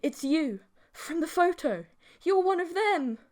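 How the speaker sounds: alarmed recognition. A narrator's reading. Narrator recoils from monster.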